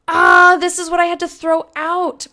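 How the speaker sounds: high and loud, a sudden realization; excited outburst. Real, not acted. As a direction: blurt it out, thrilled and annoyed. Realizes the discarded item.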